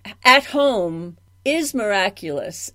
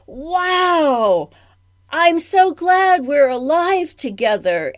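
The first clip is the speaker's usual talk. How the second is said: higher and louder, a gently excited wow; delighted audience member; 5 semitones higher, 4 dB louder than usual. Retold or imitated. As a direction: voice the thrilled audience leaving the theatre. Audience leaving the show, voiced.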